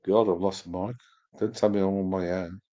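normal talking voice

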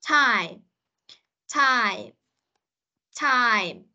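time with the full ai glide and closing m; corrective model. Shown as a demonstration. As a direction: say time slowly apart, as a teacher correcting. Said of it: "cách phát âm của Huyền nó vẫn chưa được chính xác".

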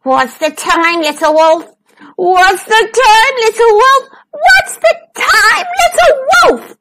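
rising from high whine to shriek; howling tantrum. A narrator's reading. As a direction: build to a full tantrum howl. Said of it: "He howled".

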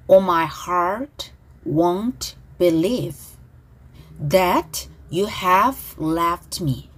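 very slow, phrase by phrase; slow pronunciation model. Shown as a demonstration. Teacher models song lyrics for students to repeat, slow and deliberate. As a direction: draw out each English word for repeating. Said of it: "큰 소리로 발음 따라하시면서".

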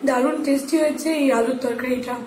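eating between words; chewing while talking. Real, not acted. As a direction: mouth partly full of food. Seen 0: Eats curry-dipped bread throughout, mouth working, food held at lips.

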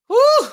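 loud, high "woo"; high whoop. Real, not acted. Reacting to a risqué revelation, high whoop. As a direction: let out a sudden high whoop. Whooping after naming the act.

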